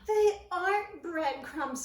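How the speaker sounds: loud, slow squeak; small mouse squeak. A narrator's reading. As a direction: squeak it out firmly. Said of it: "squeaked Christopher".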